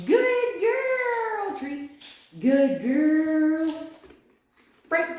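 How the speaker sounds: very high, loud gushing praise; high excited praise. Real, not acted. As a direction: gush over the dog.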